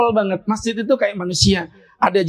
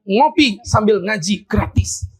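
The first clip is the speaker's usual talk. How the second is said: slow slogan; slower than usual. Shown as a demonstration. Reads out the slogan sign.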